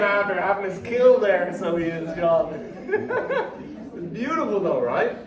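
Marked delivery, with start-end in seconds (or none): 2.7-3.9 high laugh. Real, not acted. Laughs at John.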